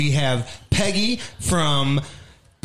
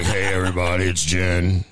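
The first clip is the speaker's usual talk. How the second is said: mock deep smoker voice; 6 semitones lower than usual. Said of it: "You're like".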